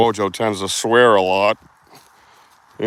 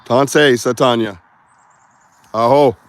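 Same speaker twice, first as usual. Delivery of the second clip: loud, slow ritual call; 7 dB louder than usual. Real, not acted. Signing off to the forest beings, a loud slow call. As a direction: send it out across the trees. Calling out into the woods.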